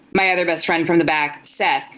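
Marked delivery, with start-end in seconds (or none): none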